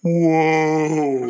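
quick mock yelp of a man falling; short mock whoa as a man drops away; imitated falling yell. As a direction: toss off a falling guy's whoa. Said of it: "when one guy goes".

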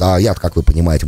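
usual speaking voice

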